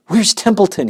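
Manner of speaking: high, loud urgent demand. Acted. Pig in a hurry demands the rat, loud and high. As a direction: bark the question. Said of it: "he demanded".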